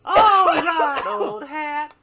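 high character voice breaking into laughter; high-voiced odd villager. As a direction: pitch it way up, drawn out.

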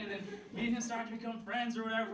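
ordinary talk

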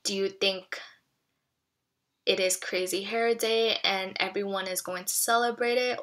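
usual speaking voice

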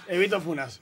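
ordinary talk, nothing marked

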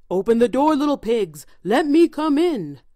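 wolf voice. A narrator's reading. Furious wolf at the door.

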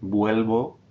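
Vuelvo with ue gliding in one syllable; Spanish word demo. Shown as a demonstration. Teacher models Vuelvo for the class, careful Spanish. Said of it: "I'll jump in with my pronunciation".

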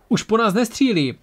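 high, amazed exclamation; surprised call. A narrator's reading. Torat tells crew the firing stopped, surprised. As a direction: announce it in astonishment, pitch up. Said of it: "zavolal překvapeně Torat".

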